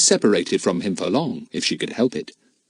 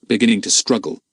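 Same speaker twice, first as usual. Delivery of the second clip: ordinary delivery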